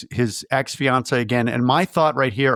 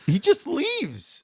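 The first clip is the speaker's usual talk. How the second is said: ordinary talk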